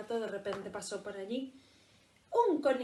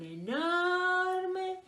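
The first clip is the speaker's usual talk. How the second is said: drawn-out reveal; 10 semitones higher, 6 dB louder than usual. A narrator's reading. Reveal of huge creature.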